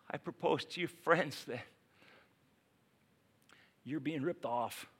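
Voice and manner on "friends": usual speaking voice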